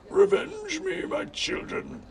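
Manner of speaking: very high, strained death cry; high, expiring villain's plea; dying gasp. A narrator's reading. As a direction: gasp it out with failing voice. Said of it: "he gasped".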